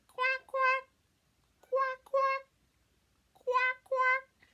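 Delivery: very high, loud squawked quacks; piercing duck call in short bursts; funny duck quack. Shown as a demonstration. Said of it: "that duck makes that funny sound".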